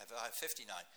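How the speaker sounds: usual speaking voice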